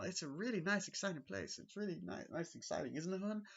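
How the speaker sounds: high, breathless mock enthusiasm; lightly voiced eager wife; imitating Wendy. Wendy praising the hotel to Jack in a high eager voice.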